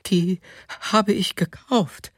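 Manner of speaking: timid, high-pitched reply; anxious. A narrator's reading. Wife repeating to her raging husband that she bought them, anxiously. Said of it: "noch einmal ängstlich".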